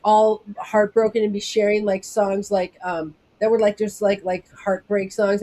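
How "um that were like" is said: usual speaking voice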